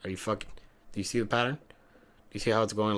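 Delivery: usual speaking voice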